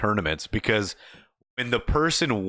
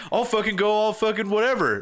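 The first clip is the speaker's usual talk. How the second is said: hyped taunt; 9 semitones higher than usual. Retold or imitated. Gamer taunting before the match, hyped mock challenge. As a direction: egg them on with swagger.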